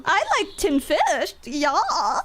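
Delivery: sing-song mock Swedish accent with swooping pitch. Shown as a demonstration. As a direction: ham up a bouncy Swedish accent. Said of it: "let me do my best, best, like, Swedish accent".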